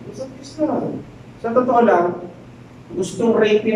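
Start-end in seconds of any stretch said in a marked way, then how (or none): 0.1-1.0 soft, sultry coaxing, rising at the end; lightly voiced temptress pleading sweetly; seductive coaxing. Wife of Potiphar tempting Joseph, soft sultry coaxing.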